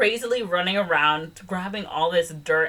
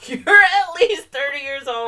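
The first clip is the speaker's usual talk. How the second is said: loud, high, incredulous scold; real disbelief, much louder and higher; exasperated scolding; 9 semitones higher, 9 dB louder than usual. Real, not acted. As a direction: berate the character for being helpless. Scolding the movie's mother.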